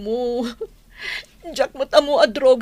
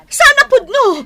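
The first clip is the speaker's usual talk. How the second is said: shocked crying; 12 semitones higher, 8 dB louder than usual. Acted. Wife hears husband arrested.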